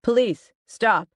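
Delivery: yelled. A narrator's reading. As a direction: cop yelling from outside. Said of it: "a cop yelled".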